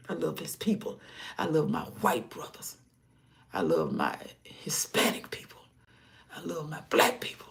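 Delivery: soft, whispered affection; loving whisper. Real, not acted. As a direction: whisper each line tenderly. Whispered love for people.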